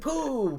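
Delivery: comic sound effect. Pretend.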